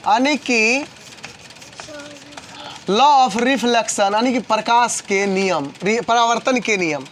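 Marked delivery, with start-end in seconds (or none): 2.9-4.1 law of reflection drawn out very slowly, word by word; slow spelled-out term. Shown as a demonstration. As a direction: say "law of reflection" slowly so students can copy it. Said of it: "इसको English में कहेंगे laws of reflection". (धीरे-धीरे शब्द अलग करके)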